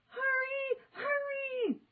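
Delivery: high, urgent scream. A narrator's reading. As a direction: scream for him to hurry. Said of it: "screamed Annie".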